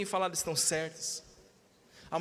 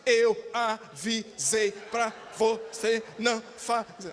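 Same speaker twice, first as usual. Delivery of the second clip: scolding mother, one hard beat per syllable; mock parental scolding broken into syllables; much slower than usual. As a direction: chop every syllable apart, slow and punched. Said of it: "eu apanhava em sílabas".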